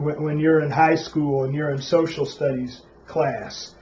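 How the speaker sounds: ordinary talk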